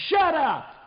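high, loud outburst; angry son's yell. Acted. Son slamming the door on his father, angry shout. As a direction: shout it sharply at the top of the voice.

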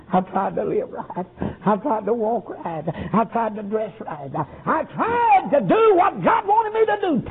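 plead, then cry out the last line; tearful pleading. Retold or imitated. Nurse pleading with the boy.

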